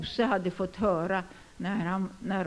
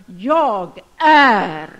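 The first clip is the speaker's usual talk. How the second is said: very loud, drawn-out answer; thundering, solemn self-declaration; mighty declaration; 11 dB louder than usual. Acted. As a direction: proclaim it with full voice. Acts Jesus answering the high priest.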